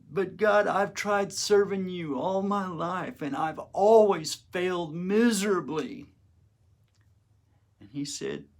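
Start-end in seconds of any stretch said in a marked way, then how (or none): 0.1-6.1 pleading. Retold or imitated. Pleading with Jesus.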